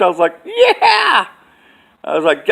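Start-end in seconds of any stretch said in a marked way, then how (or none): 0.4-1.3 high, loud, drawn-out yeah; victory yell. Retold or imitated. Man reliving the moment the drone fell, loud victory yell. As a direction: cheer like you just won it all. Triumphant cheer again.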